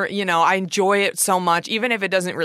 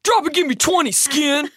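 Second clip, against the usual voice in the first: lightly barked drill sergeant order, higher; 7 semitones higher than usual. Pretend. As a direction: bark it like a playful drill sergeant. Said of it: "Just like a child in military school".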